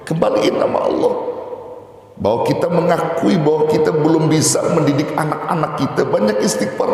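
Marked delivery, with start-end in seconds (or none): none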